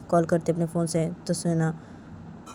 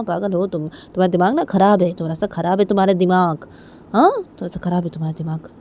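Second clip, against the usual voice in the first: raised argumentative voice with insistent repeats; taunting, annoyed father; 4 semitones higher, 7 dB louder than usual. Acted. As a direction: throw the insult right back at her. (चिढ़ाते हुए झगड़ते पापा)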